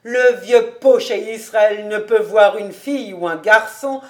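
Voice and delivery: loud, amused teasing; hearty mocking exclamation; roaring with amusement. A narrator's reading. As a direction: tease loudly, on the edge of laughter. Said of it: "s'écria Cobus en riant aux éclats".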